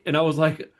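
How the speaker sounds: ordinary talk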